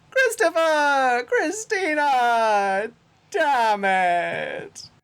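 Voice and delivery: put-on very high, slow, far-off witch shriek of the kids' names; fairy tale witch. Mother calling the kids in from the yard, as a shrieking witch. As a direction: screech it like a witch flinging open the shutters. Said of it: "like a fairy tale witch".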